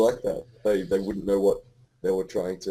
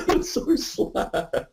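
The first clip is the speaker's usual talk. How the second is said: laughing; 14 semitones higher, much slower than usual. Real, not acted. Laughing reaction.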